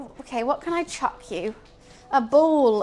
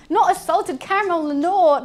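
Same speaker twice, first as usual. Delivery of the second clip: firm telling-off; 5 semitones higher, 7 dB louder than usual. Real, not acted. Scolding cat over chocolate.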